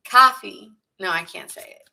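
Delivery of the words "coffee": louder go at a Jersey "Coffee", pitched up; second Jersey accent try. Shown as a demonstration. Reader retrying the Jersey accent, louder and bolder. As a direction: punch out "Coffee" again going for New Jersey. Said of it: "trying to get a Jersey accent in me".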